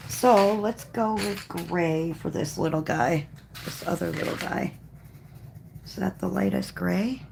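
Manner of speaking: ordinary talk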